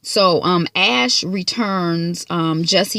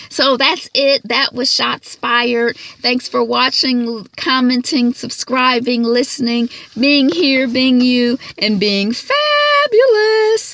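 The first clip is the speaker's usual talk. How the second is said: higher and louder, building to a drawn-out high finish; excited sign-off; 5 semitones higher, 4 dB louder, slower than usual. Real, not acted. Vlogger thanking viewers in a fabulous outro. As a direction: sign off with a flourish.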